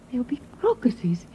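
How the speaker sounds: hushed, breathless whisper of hope; dreamy whispered longing. Acted. Dreamy mother imagines spring.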